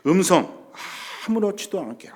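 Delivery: ordinary delivery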